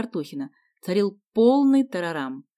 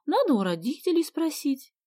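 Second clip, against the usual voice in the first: put-on higher-pitched hesitant girl; pondering; 8 semitones higher than usual. A narrator's reading. Sonya weighing Vasya's invitation, thoughtful. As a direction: think it over aloud, pitched up. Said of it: "задумалась Соня".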